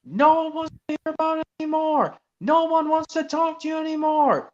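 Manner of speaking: high pitched cross engine voice; cross Percy. Retold or imitated. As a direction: scold him like a fed-up small engine. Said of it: "said Percy crossly".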